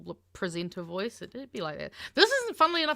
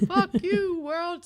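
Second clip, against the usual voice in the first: mock shout; 11 semitones higher, 5 dB louder, slower than usual. Echoing the ad's punchline loudly.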